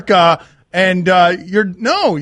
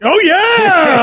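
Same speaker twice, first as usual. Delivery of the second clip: higher and louder hype shout; excited cheer; 8 semitones higher, 4 dB louder than usual. Real, not acted. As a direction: fire up the crowd. Host hypes the performer.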